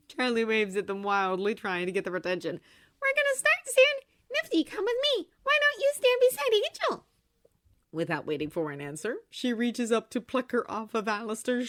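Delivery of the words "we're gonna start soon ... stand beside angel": put-on high, bright girl's voice; bubbly young woman; cheerful young woman voice. A narrator's reading.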